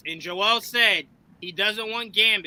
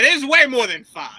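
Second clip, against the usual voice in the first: raised, louder mock protest; mock dismissive objection; 5 semitones higher, 7 dB louder than usual. Host teasing Joel over the list, mock protest. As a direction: imitate someone pooh-poohing, high and loud. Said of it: "you poo-pooed it".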